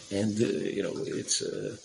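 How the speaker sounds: ordinary talk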